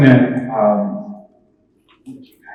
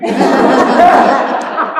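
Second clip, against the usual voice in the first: burst of laughter; 13 semitones higher than usual. Real, not acted. Laughs at the financial guy.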